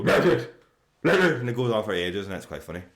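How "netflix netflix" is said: loud, higher garbled nonsense over the word; jamming the voice command with noisy gibberish; garbled blurting. Shown as a demonstration. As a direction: blurt a mangled "Netflix" to put someone off. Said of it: "just has to put them off".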